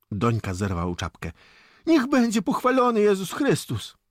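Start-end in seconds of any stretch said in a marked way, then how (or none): 1.9-4.0 very high, loud pious greeting; hailing greeting. Acted. Boy doffs cap to soldiers, hailing them. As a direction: call the greeting out in a high boyish voice.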